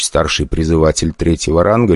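ordinary delivery

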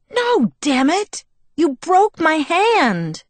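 high, drawn-out wail of outrage; pained angry outburst. A narrator's reading. Bella blaming Jacob for her broken hand, wailing angrily. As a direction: wail the accusation, stretching the words.

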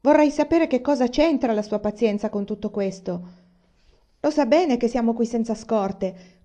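furious at the superintendent; wife venting outrage at the railway; indignant tirade. A narrator's reading. Mother angry at superintendent.

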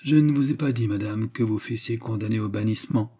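ordinary delivery, nothing marked

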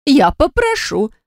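high, slow heartfelt promise. A narrator's reading. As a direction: promise it with feeling, voice lifting. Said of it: "Татьяна с чувством закивала".

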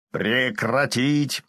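slow commanding order; drawn-out stern order. Acted. Necromancer's command.

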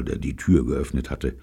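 ordinary delivery, nothing marked

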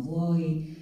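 ordinary delivery

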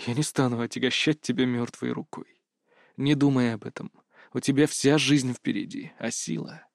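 soft, low consoling voice; gentle refusal. A narrator's reading. Kris gently refuses to burden young Berta, low and soft. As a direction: let her down quietly, fading off at the end.

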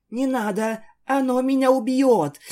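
terrified plea. A narrator's reading. Terrified Isabel begs, trembling.

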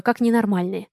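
plain reading voice

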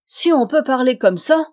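cri indigné, plus haut et fort; s'écrier. Acted. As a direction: protestent à pleine voix. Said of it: "s'écrièrent les quatre gotons". (les commères s'écrient, outrées)

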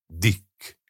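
one short word, squeezed out with effort; a strained, understated utterance; forced out. A narrator's reading. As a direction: force the name out reluctantly. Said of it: "préselte ki magából Fred".

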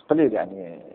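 usual speaking voice

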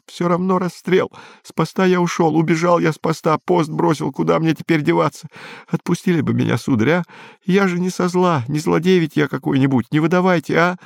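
high, whimpering plea; thin, high-pitched sniveling voice; tearful muttering. A narrator's reading. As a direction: babble a confession and beg pitifully. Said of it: "забормотал он".

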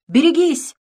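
sudden high, loud warning shout; grenade warning yell. A narrator's reading. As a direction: yell the warning to take cover. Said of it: "вдруг крикнул он".

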